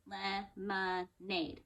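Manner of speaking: Lemonade slow, each syllable apart; clapped out syllables. Shown as a demonstration. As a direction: clap Lemonade out slowly. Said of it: "Let's clap it out".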